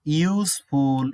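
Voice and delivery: ইউজফুল drawn out very slowly with a clear "iu" start; slow modelled ইউজফুল; slow demo pronunciation. Shown as a demonstration. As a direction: stretch ইউজফুল for the class. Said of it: "ইউ এর মতো উচ্চারণ হচ্ছে".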